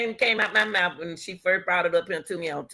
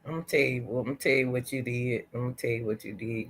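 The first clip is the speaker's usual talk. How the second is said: low, soft and fast; quiet, rushed I'm gonna tell you; low quick warning; 8 semitones lower, 9 dB quieter, faster than usual. Real, not acted. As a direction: lean in before the accusation. Building to accusation.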